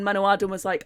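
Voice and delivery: usual speaking voice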